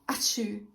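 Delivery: loud acted sneeze. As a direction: give a big sneeze. Jamie sneezes, allergy.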